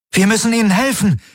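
high, urgent cry; calling out. A narrator's reading. Kirai urges help for the others, high urgent cry. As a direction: plead for action in a high voice. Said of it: "rief Kirai".

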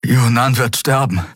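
mühsam mit erhöhter Stimme; keuchend. A narrator's reading. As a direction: sprich noch außer Atem. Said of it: "keuchte Kai". (leicht gekeucht)

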